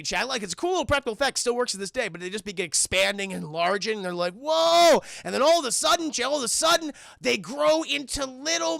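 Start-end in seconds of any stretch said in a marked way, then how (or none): 4.3-5.0 loud, high, drawn-out whoa; acted amazement. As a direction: marvel out loud, pitched up. Acted amazement at expanding creatures.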